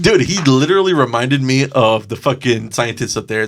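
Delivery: ordinary talk, nothing marked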